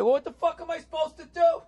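high, helpless mock whine; exasperated banker. Comic quotes drunk banker giving up, high whine. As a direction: throw up your hands, high.

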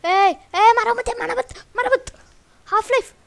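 very high, very loud frantic yells; panicked screaming. Real, not acted. Gamer losing health to a creeper, panicked screaming. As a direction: scream for the attacker to stop.

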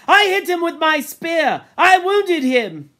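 high, loud insistence; indignant. Acted. Ralph claiming credit for wounding the boar, indignant. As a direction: insist hotly on being heard. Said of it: "Ralph said indignantly".

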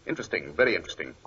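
low, soft, fast murmur to himself; quick low muse, half to himself; musing aside. Acted. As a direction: mutter it under your breath, thinking. Holmes muses over clue.

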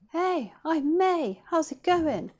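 practice greeting. Shown as a demonstration. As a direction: rehearse a smooth hello. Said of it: "what are you gonna say?".

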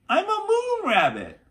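high, loud gleeful call. A narrator's reading. Annie bounding on the moon, calling out gleefully. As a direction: shout it bouncing with joy. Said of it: "she called".

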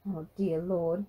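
usual speaking voice

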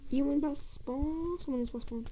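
ordinary talk

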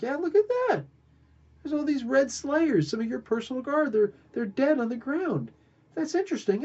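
pitched well up, pointing things out; high mock guide voice. Guide shows the king his dead guards, high breezy voice.

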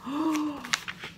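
soft, drawn-out gasp; audible intake of wonder; storyteller's gasp. Acted. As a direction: gasp quietly in pretend surprise. Storyteller reacts.

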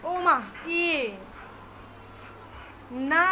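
usual speaking voice

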